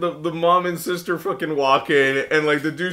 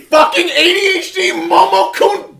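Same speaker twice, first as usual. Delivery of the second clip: high, loud burst of playful abuse; mock angry outburst; 12 semitones higher, 7 dB louder than usual. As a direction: yell a joking insult at a friend.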